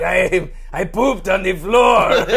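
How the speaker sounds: high, loud, slow shouted brat voice; Ducky's evil side. Acted. Guest shows Ducky's evil side, a high loud shout. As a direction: yell it like a gleeful little menace. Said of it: "Ducky's evil side".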